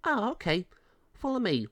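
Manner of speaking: high chirpy officer voice; brisk, high officer leading the way; pound officer. A narrator's reading. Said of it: "Dog pound officer says".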